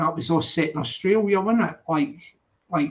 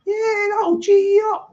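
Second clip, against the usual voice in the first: much higher and louder, jumping up; excited pitch leap; 14 semitones higher, 4 dB louder than usual. Shown as a demonstration. Streamer pumping up his viewers, high excited burst. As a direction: fire them up with a sudden high burst. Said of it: "I've just geed you up".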